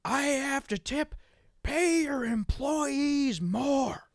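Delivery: high, drawn-out mock holler; slow, high-pitched ranting impression; imitated yelling. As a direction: bellow each word slowly like an irate customer. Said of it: "he kept yelling that".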